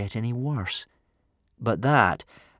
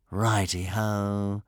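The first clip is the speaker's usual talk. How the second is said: drawn-out, lightly cheery acknowledgement; cheery reply; much slower than usual. A narrator's reading. Dragon agreeing to fetch rescue, cheerily. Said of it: "replied Horror Cow cheerily".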